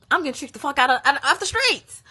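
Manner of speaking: loud, high-pitched venting; exasperated outburst. Real, not acted. Venting to viewers about being tricked, exasperated and loud.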